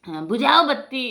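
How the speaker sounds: high, loud imitated telling-off; acted-out miserly father-in-law's outburst; scolding old man. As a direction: scold the daughter-in-law, pitched right up. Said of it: "ऐसे बोलता था दोस्तों".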